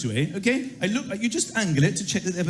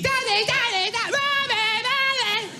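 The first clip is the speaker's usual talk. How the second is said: put-on very high wailing kid voice; screaming kids; 13 semitones higher than usual. Children screaming for their parents all night. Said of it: "they're screaming".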